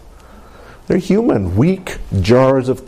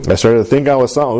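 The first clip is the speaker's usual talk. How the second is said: usual speaking voice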